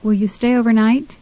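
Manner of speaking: "overnight" stressed on NIGHT, rising; third-syllable stress. Shown as a demonstration. Tutor models a question about staying, stress on NIGHT in "overnight". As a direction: ask it with the weight on the end of "overnight". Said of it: "third syllable in the word overnight is stressed".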